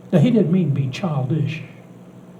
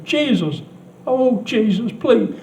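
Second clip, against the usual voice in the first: put-on crying child; 6 semitones higher, slower than usual. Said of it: "began to cry".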